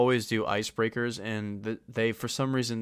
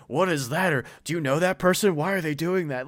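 higher, louder mimicry of puzzled parents; baffled parents; 6 semitones higher, 6 dB louder than usual. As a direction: fire off bewildered questions like a parent. Parents' baffled questions, voiced by child.

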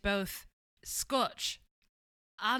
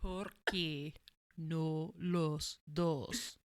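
Scottish accent; 4 semitones lower, 4 dB quieter, much slower than usual. Shown as a demonstration. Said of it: "really hard to say in a Scottish accent".

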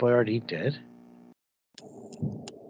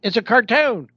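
louder and higher, fed up; exasperated shout; 9 semitones higher, 8 dB louder than usual. Real, not acted. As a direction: shout it in disbelief. Heated argument about Mario casting.

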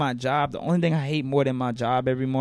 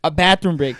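ordinary delivery

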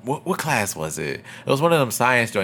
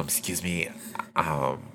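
soft, low, restrained voice; retold office summons; 5 semitones lower, 13 dB quieter than usual. Teacher calling a student to the principal's office, soft mock summons. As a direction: act out a hushed summons.

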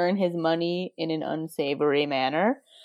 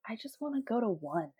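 wistful hush; 4 semitones higher, 12 dB quieter, faster than usual. Retold or imitated. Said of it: "I'm like".

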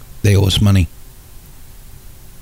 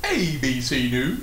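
news announcer; 8 dB quieter, slower than usual. Pretend. Mock news slogan echo.

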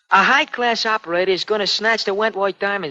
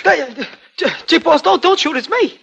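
scared pleading; 7 semitones higher, 4 dB louder than usual. Acted. Startled crook begging don't shoot.

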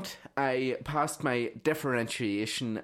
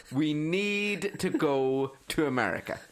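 exaggerated American accent, slow and higher; mock American accent; 5 semitones higher, slower than usual. Comic replaying a director's jibe, in a drawn-out fake American accent. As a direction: drag it out as a terrible American accent. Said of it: "I tried to do an American accent".

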